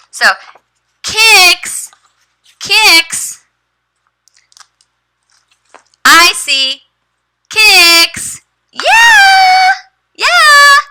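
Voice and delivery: slow, high, drawn-out word modelling, ending in a very high cheer; teacher voice. Shown as a demonstration. Teacher sounds out kicks for her student and then cheers very high. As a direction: stretch the word out for a young learner, then celebrate.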